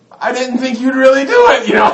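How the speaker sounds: high, loud hammed-up bawling; wailing child. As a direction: protest in a mock tearful scream. Child wails before spanking.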